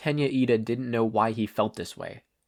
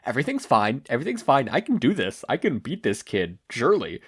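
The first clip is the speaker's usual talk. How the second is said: imitating him talking himself into it; mock nervous villain; 3 semitones higher than usual. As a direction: reader recapping the villain's denial, lightly mocking. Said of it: "All for One gaslighting himself".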